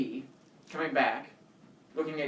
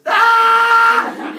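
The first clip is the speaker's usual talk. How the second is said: put-on high loud child scream; 17 dB louder than usual. Terrified kid screaming at the man. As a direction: scream in terror. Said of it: "I scream at everything he says".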